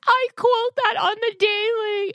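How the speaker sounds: very high, squeaky tween voice; tween daughter voice. Retold or imitated. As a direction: mimic a horrified eleven-year-old. Mom imitating daughter's panic.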